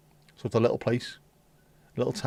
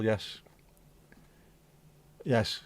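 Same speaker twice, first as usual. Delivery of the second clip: normal talking voice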